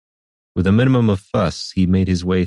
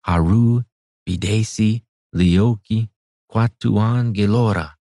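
chanting muffled through a wall; a wizard's chant heard from inside; 3 semitones higher, slower than usual. A narrator's reading. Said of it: "came a muffled voice from within".

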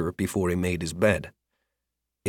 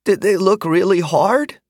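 put-on higher, pleading child's voice; quavering voice; 11 semitones higher, 4 dB louder than usual. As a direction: fake worry like a young boy. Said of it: "in what he hoped was a quavering voice".

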